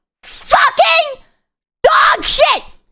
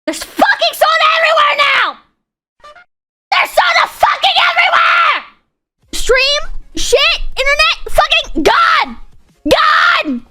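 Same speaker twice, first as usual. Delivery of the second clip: furious real yelling; 3 semitones higher, 3 dB louder than usual. Real, not acted. Streamer raging after spilling soda and his internet dying. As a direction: scream it out in real frustration, voice breaking.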